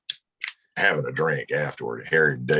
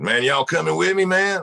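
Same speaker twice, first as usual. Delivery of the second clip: higher, louder, eager tipsy pal; pitched-up, louder buzzed invitation; buzzed Dave; 13 semitones higher, 5 dB louder than usual. Retold or imitated. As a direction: call out to your buddy hoping he'll come along. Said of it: "And he said".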